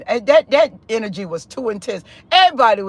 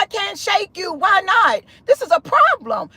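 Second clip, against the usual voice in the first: high-pitched frustrated protest; worked up and pitched high; retold exasperation; 9 semitones higher than usual. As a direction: reenact a baffled complaint, pitch climbing. Retells exasperated words to the man.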